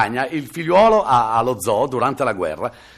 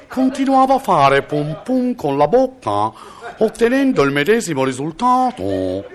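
pompous citation; 11 semitones higher, slower than usual. Pretend. Mock solemn medal citation.

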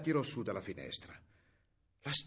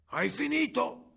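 high, loud, drawn-out call; grandmother calling; 9 semitones higher, 5 dB louder, slower than usual. A narrator's reading. Grandmother calling down to girl in the stable, drawn-out call. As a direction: call down in a long high shout. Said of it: "disse la nonna".

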